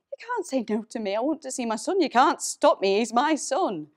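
loud, high, desperate demand; desperate protest. Acted. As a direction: plead and insist, building to a shout. Mother refused sight of son.

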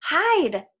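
high, loud urgent warning; hissed warning. A narrator's reading. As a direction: warn friends urgently in one word. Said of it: "Annabeth hissed".

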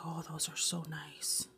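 softly whispered remembered reaction; retold whisper. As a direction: whisper it as if recalling. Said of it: "I was like".

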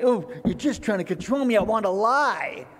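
loud, petulant mock objection; mock protest. Preacher mimicking someone objecting to a commandment, mock protest. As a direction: protest like a thwarted child, stretching the last words.

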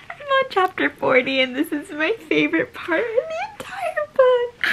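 high giggly squeal, half sobbing; laughing squeal. Real, not acted. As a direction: squeal through giggles over the book. Reaching her favourite part.

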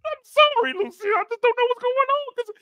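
high strained pleading; hammed-up heartbroken sorry; mock tearful apology. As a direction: plead as if about to cry. Charles breaking down apologizing.

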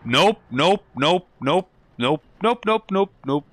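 high, loud yelped nopes, one after another; frantic string of nopes; panicked nopes. Real, not acted. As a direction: fire off each nope in a panic, fading. Panicked repeated refusals at a scare.

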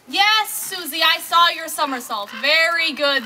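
loud, bright shouted praise; calling out. Acted. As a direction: call praise to a child far off. Praising child from shore.

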